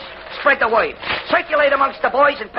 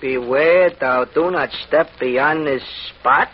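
ominous poem reading; 10 semitones lower, much slower than usual. Acted. Reading mysterious poem aloud.

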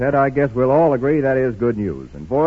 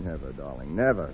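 much softer, low and gentle; tender promise; 12 semitones lower, 6 dB quieter than usual. Acted. Husband promising his wife never to quarrel, soft and tender. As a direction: murmur it to soothe her.

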